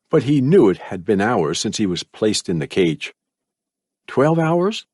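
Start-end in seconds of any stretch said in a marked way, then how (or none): 4.1-4.9 higher, doubtful thought; trapped boy wondering to himself, higher; inner thought. A narrator's reading. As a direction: wonder it to yourself in the boy's voice. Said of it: "he thought".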